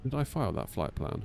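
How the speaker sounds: usual speaking voice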